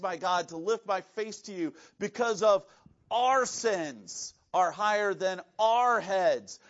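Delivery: reads the prayer aloud, rising in force; scripture reading. Acted. Pastor reading Ezra's prayer to the congregation, building earnest. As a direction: read Ezra's prayer with growing weight.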